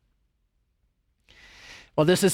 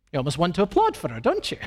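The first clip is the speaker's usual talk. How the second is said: normal talking voice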